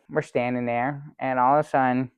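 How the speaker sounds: ordinary talk, nothing marked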